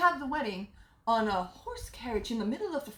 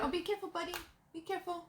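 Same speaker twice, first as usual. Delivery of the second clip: soft caring caution, higher pitch; sharp playground warning; 5 semitones higher, 6 dB quieter, faster than usual. Real, not acted. Warning to a child.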